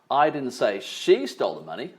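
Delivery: she punched and lifted; stress on SHE. Shown as a demonstration. Trainer hinting someone else took it, stress on SHE. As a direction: put all the weight on she. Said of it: "if I put emphasis on different words".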